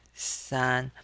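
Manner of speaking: san held high and level; first tone. Shown as a demonstration. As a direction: keep each "san" flat up high. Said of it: "pronounce this with the first tone".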